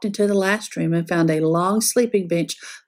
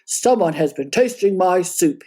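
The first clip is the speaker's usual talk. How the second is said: big bear voice with a mild roar; roaring Papa Bear; 2 semitones lower than usual. A narrator's reading. Papa Bear to his family about his soup, gruff mock roar. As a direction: play the papa bear, gruff and a little angry. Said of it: "he roared".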